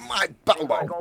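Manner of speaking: Mike Pillow impression. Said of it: "I've never done a Mike Pillow impression".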